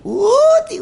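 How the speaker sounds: high, loud bewildered where; mock lost-in-the-city cry. Lost in Yeouido, exclaiming. (높고 크게 당황한 외침)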